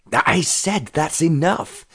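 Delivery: high, slow exasperated yell. A narrator's reading. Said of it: "he yelled".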